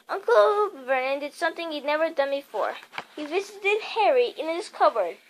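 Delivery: usual speaking voice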